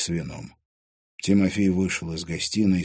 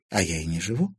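ordinary delivery, nothing marked

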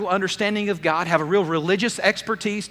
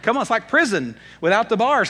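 ordinary talk, nothing marked

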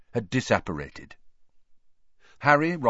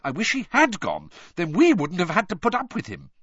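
high, louder, indignant outburst; angry; 10 semitones higher, 5 dB louder than usual. A narrator's reading. Girl venting to friends about rival, angry. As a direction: vent crossly. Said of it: "she said angrily".

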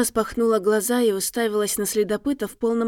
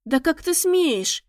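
outraged; 7 semitones higher, slower than usual. A narrator's reading. Lady outraged at tracker.

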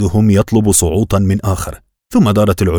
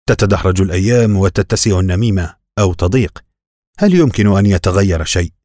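ordinary delivery

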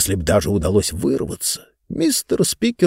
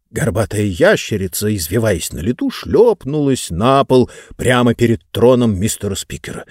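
usual speaking voice

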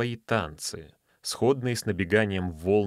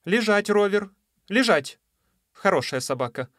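loud, high commands to a dog; stern command; 10 semitones higher, 4 dB louder than usual. Acted. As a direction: order the dog down sharply. Lady commands her sea dog.

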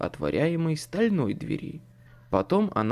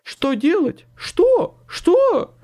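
loud, shrieked repeats climbing very high; rising panic; 8 dB louder, much slower than usual. A narrator's reading. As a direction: squeal each question higher. Second man panics, repeats his question.

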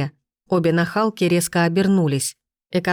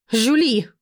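shrieked name; 6 semitones higher than usual. A narrator's reading. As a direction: screech for the maid. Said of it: "взвизгнула она".

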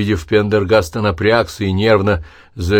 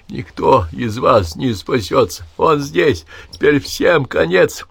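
doom prophecy; 7 semitones higher, slower than usual. A narrator's reading. Dying man's doom prophecy.

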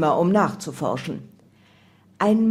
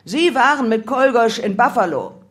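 loud, raised-pitch interrogation; bellowed accusation; 4 semitones higher, 6 dB louder than usual. A narrator's reading. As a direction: roar at the prisoner to confess. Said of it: "Einer brüllte".

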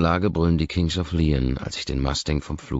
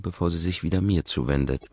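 plain reading voice

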